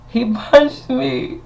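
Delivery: very high, slow squeaky complaint; mock hurt whine. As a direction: drag it out high like a hurt child. Retold protest to staring crowd.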